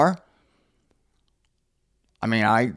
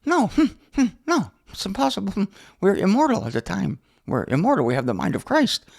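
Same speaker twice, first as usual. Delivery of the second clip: mousy little voice, pitched up; James Corum; 5 semitones higher than usual. Acted. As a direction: imitate a mousy man with small hums. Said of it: "That's how he talked. A little mousy".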